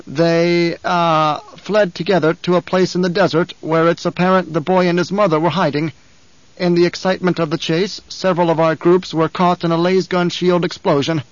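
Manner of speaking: a frightened underling hurrying to please; anxious guard captain. Acted. Captain voiced through report.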